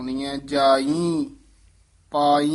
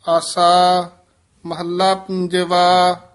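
slow, louder, drawn-out chanting of the verse; scripture recitation; 3 semitones higher, 6 dB louder, much slower than usual. Acted. Teacher reciting the shabad for the class, slow and louder. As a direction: recite it slowly and clearly to the class.

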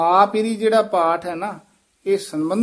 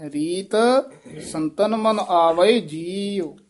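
ਰੀਤ, short pause, then slow drawn-out phrase; halt after ਰੀਤ; 2 semitones higher, slower than usual. Shown as a demonstration. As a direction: separate ਰੀਤ, stretch what follows. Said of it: "ਇੱਥੇ ਯਮਕ ਲਾ ਦੇਣੀ ਹੈ".

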